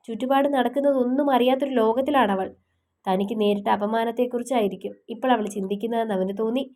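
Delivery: plain reading voice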